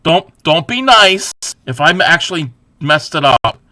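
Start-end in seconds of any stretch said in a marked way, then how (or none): none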